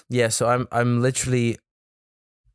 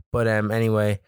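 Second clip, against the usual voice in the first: ordinary delivery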